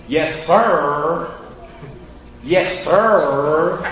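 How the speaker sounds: curt, grudging yes sir; brush-off yes sir, rising; dismissive yes sir. Retold or imitated. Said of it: "a tone of voice".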